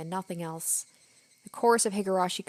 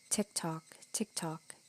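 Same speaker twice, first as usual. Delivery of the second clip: soft unvoiced whisper, much softer; whispered tick-tock; 2 semitones lower, 8 dB quieter, slower than usual. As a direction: breathe it like a ticking clock. [name] counts the clock hands.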